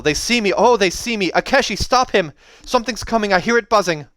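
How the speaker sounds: high, loud, frantic; panicked cry. A narrator's reading. Kel in trance as it sees her, panicked cry. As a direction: panic as something finds you.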